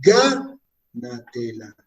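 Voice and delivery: teacher splitting stressed GA from the rest of "gánatela"; stress on GA, split. Shown as a demonstration. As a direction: stretch GA out, break, then drop through "na-te-la" in "gánatela". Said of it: "tónico, ga".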